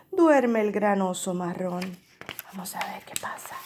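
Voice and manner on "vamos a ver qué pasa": fast hushed whisper. Shown as a demonstration. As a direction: lean in and whisper it quickly. Whispered aside to the class.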